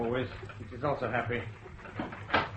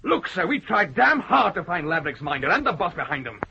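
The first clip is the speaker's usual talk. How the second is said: indignant, louder and fast by the end; angry outburst; 4 semitones higher, 5 dB louder than usual. Acted. Officer snapping back at the judge over the search, angry and loud. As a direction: hit back hard at the judge's jibe. Officer angrily defends his team.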